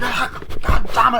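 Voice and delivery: loud, high, drawn-out mock yell; fighting yell. Girls fighting over the phone, loud high yell. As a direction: yell out as if in a scuffle.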